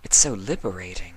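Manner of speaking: lightly voiced mare musing to herself; a hint of relief in a private thought; Octavia's thoughts. Octavia thinking to herself on the couch, lightly voiced.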